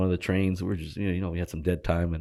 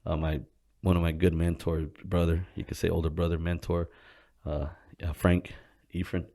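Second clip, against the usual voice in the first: usual speaking voice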